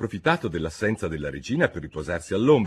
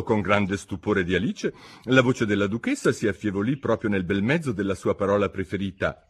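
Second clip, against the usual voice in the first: ordinary delivery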